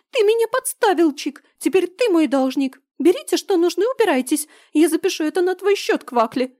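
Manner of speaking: high squeaky shouting trader; shrill little creature yelling. Acted. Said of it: "послышался песклявый голос хозяина".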